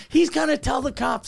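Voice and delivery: high-pitched imitation of a panicked girlfriend; mock alarm, voice pushed up; freaking out. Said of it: "Like, she's freaking out".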